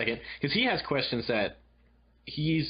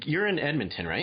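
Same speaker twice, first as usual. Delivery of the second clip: ordinary talk, nothing marked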